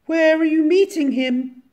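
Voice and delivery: very high hooting owl voice; puzzled owl. A narrator's reading. Owl questions mouse in story.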